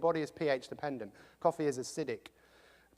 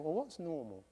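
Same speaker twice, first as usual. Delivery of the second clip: soft, puzzled echo of the question; turning the question over quietly; quiet musing; 3 semitones lower, 11 dB quieter than usual. Retold or imitated. Mulling over the guru's question to himself, softly. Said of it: "and I was going".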